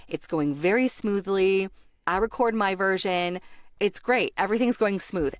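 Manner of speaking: normal talking voice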